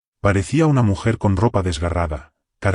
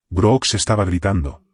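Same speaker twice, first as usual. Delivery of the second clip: ordinary delivery, nothing marked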